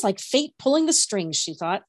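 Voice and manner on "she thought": usual speaking voice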